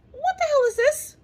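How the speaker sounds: high-pitched incredulous question; acted high voice of alarm; alarmed bewilderment. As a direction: ask in squeaky disbelief. Acted shocked question to nurse.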